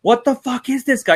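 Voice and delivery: mildly freaked-out bystander, imitated; imitated freaking out. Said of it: "a couple people started freaking out".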